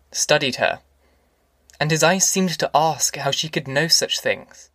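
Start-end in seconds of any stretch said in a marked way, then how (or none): none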